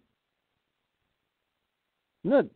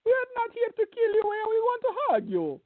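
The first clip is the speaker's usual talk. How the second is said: much higher, faster mock-innocent voice as the attackers. Mocking the attackers who swear they only want a hug, in a high sham-innocent voice. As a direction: squeak it out like a sham peacemaker.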